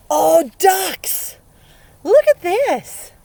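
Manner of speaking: high and loud, stretched; excited discovery. Real, not acted. Teacher showing kids the ducks page, excited. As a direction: gasp with delight.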